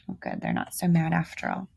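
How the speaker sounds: usual speaking voice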